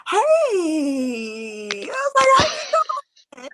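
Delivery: high excited squeal; super excited. Retold or imitated. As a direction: greet him, thrilled and high. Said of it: "I was so, sized".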